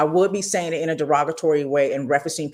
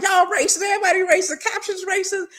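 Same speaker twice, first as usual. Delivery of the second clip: mock indignant rant. Arguing with TV captions.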